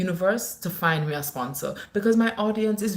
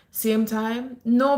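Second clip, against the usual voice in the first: usual speaking voice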